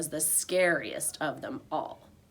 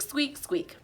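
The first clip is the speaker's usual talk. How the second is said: ordinary delivery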